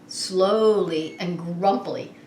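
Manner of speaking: ordinary delivery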